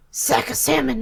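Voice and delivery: drawn-out, showy catchphrase delivery; called-out catchphrase. Shown as a demonstration. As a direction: say it like a punchy catchphrase. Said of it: "you gotta say it like that".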